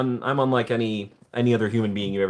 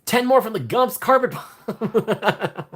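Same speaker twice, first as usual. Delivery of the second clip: high, slow amazed announcement; excited; 11 semitones higher, 3 dB louder, much slower than usual. Real, not acted. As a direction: shout out the gift slowly. Reacting to gifted subs.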